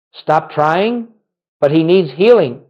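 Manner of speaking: ordinary talk, nothing marked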